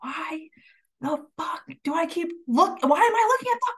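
high, loud baffled outcry; rising disbelief, almost shrieking; incredulous outcry. Retold or imitated. Confused outburst during labor.

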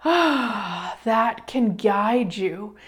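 sigh, then very slow words. Real, not acted. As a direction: breathe out and ease into it.

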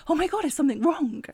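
imitating her own worried reaction; alarmed. Retelling her alarm at body's answer.

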